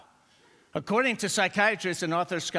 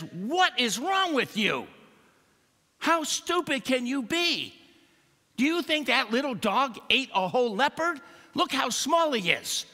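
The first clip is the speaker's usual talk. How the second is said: high, scornful monkey voice; scolding monkey; 9 semitones higher, 3 dB louder than usual. Acted. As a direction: scold the leopard from above, mocking. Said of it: "finally catches up ... and says".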